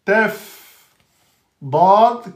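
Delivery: usual speaking voice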